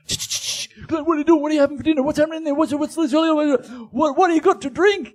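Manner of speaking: high, loud rapid-fire questions; mock reporters hounding a guest; pestering paparazzi. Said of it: "they're going".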